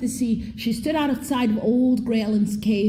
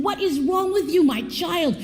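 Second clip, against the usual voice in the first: exasperated mother; 7 semitones higher than usual. Acted. Exasperated mother to crying baby.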